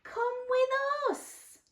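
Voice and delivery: high, slow storybook call; high slow inviting voice; character voice. Acted. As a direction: invite them along sweetly.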